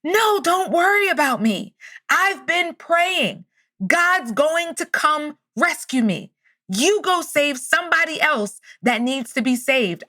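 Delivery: high, slow, drawn-out holler; mock loud yell. Man on roof refuses helicopter, mock yelling up. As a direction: imitate yelling up to a helicopter, no real volume. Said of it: "the guy yells up so loud".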